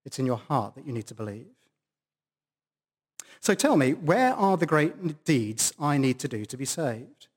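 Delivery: ordinary talk, nothing marked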